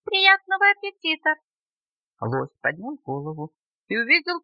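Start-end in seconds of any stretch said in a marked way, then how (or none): 0.1-1.4 tiny high-pitched bug, courteous; squeaky little beetle wishing someone well; polite squeaky little voice. A narrator's reading. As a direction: pipe it up high and loud, politely. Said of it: "вежливый писклявый голосок".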